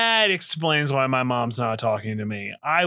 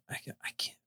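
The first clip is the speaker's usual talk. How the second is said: whisper; 18 dB quieter, faster than usual. Real, not acted. Trails into a whisper.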